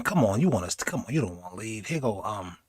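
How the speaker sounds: soft, low sweet-talk; coaxing. Retold or imitated. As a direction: lure them to stay quietly. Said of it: "That was them saying".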